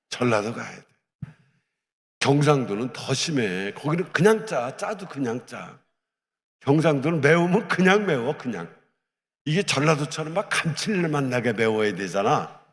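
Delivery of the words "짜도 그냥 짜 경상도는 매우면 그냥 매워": usual speaking voice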